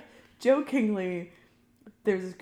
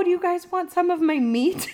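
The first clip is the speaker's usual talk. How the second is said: eager offer; 10 semitones higher, 7 dB louder than usual. Retold or imitated. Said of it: "I was like".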